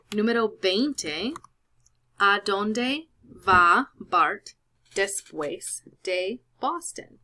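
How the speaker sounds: each word drawn out slowly for the class; slow careful reading. Shown as a demonstration. Teacher reading the Spanish question slowly to students. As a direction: read it slowly so students can follow.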